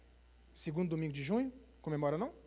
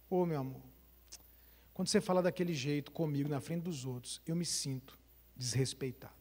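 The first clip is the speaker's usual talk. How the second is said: soft, low, tender complaint; gentle confession; 6 semitones lower, 6 dB quieter than usual. Retold or imitated. Husband in the car telling his wife how he felt, gently. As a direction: tell her gently how it hurt.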